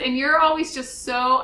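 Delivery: ordinary talk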